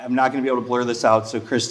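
normal talking voice

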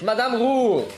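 loud high call across the room. Acted. Officer calls loudly for the hostess Madame Roux. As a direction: shout for the landlady. Calling out for the punch.

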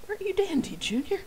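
high, bright aren't you dandy, Junior with no sarcasm; bright cheerful take. Retold or imitated. Narrator imitates Tamura's line as a cheerful greeting. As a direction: chirp it like a cheerful friend. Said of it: "Bright, cheerful, and without a hint of sarcasm".

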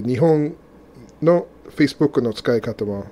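ordinary talk, nothing marked

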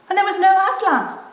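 high, loud storyteller's reveal; shocked narration. A narrator's reading. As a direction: cry out the shocking discovery.

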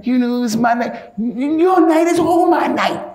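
high-pitched, put-on quarrelling wife; acted jealous tirade, stumbling over the words. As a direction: whine and protest in a high mock-female voice. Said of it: "She, the girl say".

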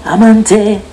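slow, drawn-out outro catchphrase; stretched sign-off. Acted. Youtuber signing off his video with his catchphrase. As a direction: savour each word of the tagline.

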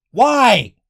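disbelieving angry outburst; exasperated rant. Real, not acted. Ranting about the removed E-Tanks.

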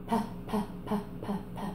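quick unvoiced p p p; demo p sounds. Shown as a demonstration. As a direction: tap out soft p sounds. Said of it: "can you start by saying".

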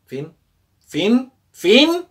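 de agudo a gritado; final teatral creciente. Real, not acted. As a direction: anuncia el fin subiendo cada vez. Anuncia el final cada vez más alto. (proclamación exagerada)

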